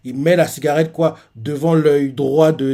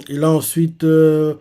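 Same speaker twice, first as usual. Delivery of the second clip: ordinary talk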